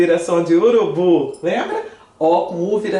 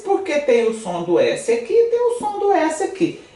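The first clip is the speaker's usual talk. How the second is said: ordinary talk, nothing marked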